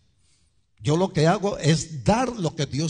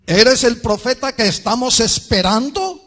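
loud, high-pitched insistent question; questioners pressing; 9 semitones higher, 7 dB louder than usual. Acted. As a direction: demand it at raised volume.

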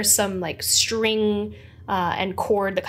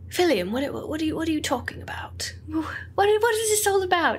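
confused whisper; 7 semitones higher, faster than usual. Acted. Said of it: "He goes".

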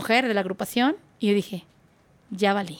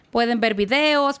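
ordinary talk, nothing marked

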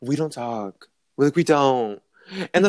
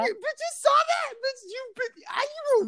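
very high excited squeals; high-pitched giddy outrage; giddy disbelief. Real, not acted. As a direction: squeal it at your friend. Disbelief friend saw it.

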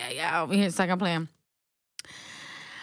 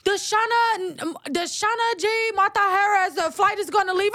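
intercom announcer; 8 semitones higher than usual. Retold or imitated. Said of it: "they like".

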